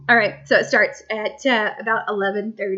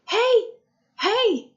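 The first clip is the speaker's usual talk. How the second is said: put-on high, raised-voice call to a child; 11 semitones higher, 4 dB louder than usual. Mom calling to sleepwalking son from the bathroom, high holler. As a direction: holler out, pitched way up. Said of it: "and I'm like".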